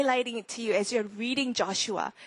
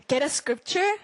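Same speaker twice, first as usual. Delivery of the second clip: ordinary delivery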